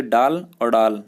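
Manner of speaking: slow डाल then rounded डॉल; drawn-out डाल and डॉल, vowels contrasted; डाल vs डॉल demo. Shown as a demonstration. Teacher slowly contrasts डाल and डॉल. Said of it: "ये तो हो गया डाल और ये हो गया डॉल".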